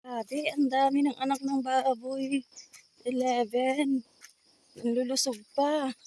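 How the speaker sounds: thrilled exclaiming; excited. Real, not acted. Amazed at the piglets.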